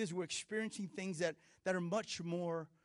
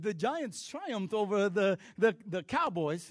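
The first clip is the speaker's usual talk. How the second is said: put-on Zamunda accent; 4 semitones higher, 8 dB louder, slower than usual. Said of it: "if you're from Zamunda".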